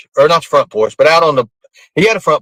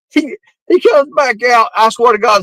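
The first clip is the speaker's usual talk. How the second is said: ordinary delivery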